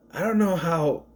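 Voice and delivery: usual speaking voice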